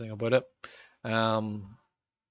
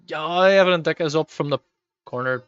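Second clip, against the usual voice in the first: sudden louder burst as the corner comes in; excited call; 4 semitones higher, 12 dB louder than usual. Real, not acted. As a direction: snap to the game, caught up in the moment. Reacts to live corner kick.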